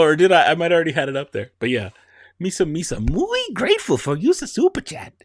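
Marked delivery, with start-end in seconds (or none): 2.4-5.1 much higher goofy Jar Jar voice; squeaky Jar Jar thanks; Jar Jar Binks. Pretend. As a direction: gush thanks like a silly Gungan. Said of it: "Jar Jar Binks impression".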